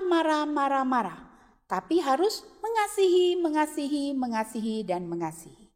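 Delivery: soft, hushed prayer voice; children's prayer. Acted. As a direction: pray gently, leading the children.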